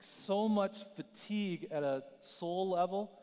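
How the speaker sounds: ordinary talk, nothing marked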